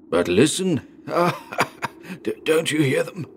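put-on high, halting old-man voice; insistent dying father. A narrator's reading. Dying father urges son to hear bells, haltingly insistent. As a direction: urge him to listen, stammering.

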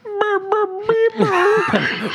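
high whiny crying laugh; a laugh that sounds like Kermit sobbing. Real, not acted. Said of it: "Why do you sound like Kermit crying?".

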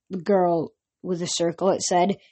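ordinary delivery, nothing marked

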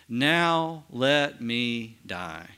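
very slow, drawn-out reading of Israel's words. Acted. To Joseph, very slow and moved. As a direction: let each word of "Now let me die," hang, very slowly.

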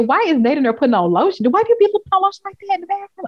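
high, incredulous retold questions, speeding up; bewildered rush of questions; baffled questioning. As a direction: puzzle aloud, pitch climbing high. Retelling own baffled reaction.

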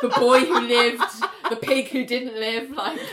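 giggling in disbelief, voice up loud; laughing at a silly plot twist; laughing incredulous. Real, not acted. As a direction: laugh through the absurd line.

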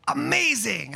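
dramatic reveal. Shown as a demonstration. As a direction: sell "Amazing" like a grand reveal after the spin. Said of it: "Could you spin ... then spin and go".

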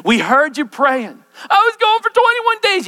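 rising to a passionate cry; excited preaching. Acted. Preacher voices the heavenly exchange, high and fervent. Preacher voicing angel and Daniel.

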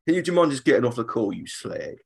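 lightly voiced grumpy Cockney bloke; mock Cockney. A hint of a Londoner complaining about grim weather in Cockney. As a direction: grumble it like a Londoner fed up. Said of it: "Ray Winston's not doing much".

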